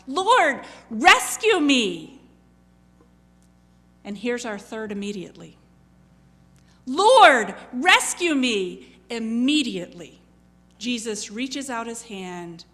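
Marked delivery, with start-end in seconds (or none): 0.1-2.2 loud, slow plea for rescue; desperate cry. Acted. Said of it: "he cries out". 6.9-9.0 loud, slow, urgent shout for rescue; louder desperate cry. Acted. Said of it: "he cries out".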